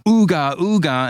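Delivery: high, hooting submarine klaxon imitation; imitated dive alarm. Sub captain sounding the alarm to dive, klaxon imitation. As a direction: mimic a klaxon with the voice.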